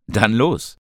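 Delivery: cheerful shout of let's go; called out. A narrator's reading. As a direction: rouse the group to head out. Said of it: "rief Ben".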